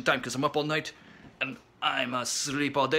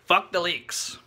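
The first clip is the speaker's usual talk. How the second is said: ordinary talk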